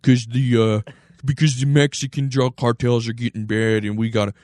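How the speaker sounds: slurred mock pundit voice; put-on, mushy-mouthed justification; Bill O'Reilly. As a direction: grumble it like a caricatured TV commentator. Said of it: "Bill O'Reilly probably is like".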